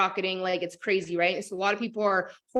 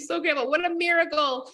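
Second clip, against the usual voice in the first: yelled gratitude; 6 semitones higher than usual. Retold or imitated. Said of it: "I yelled out with gratitude".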